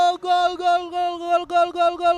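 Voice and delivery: high, loud ecstatic goal cry; goal call. Real, not acted. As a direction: scream gol over and over.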